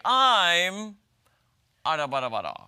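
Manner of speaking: a hint of anchor with pauses; hammy newscaster stumbling through a pause; mock news anchor. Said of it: "sometimes they do the pauses".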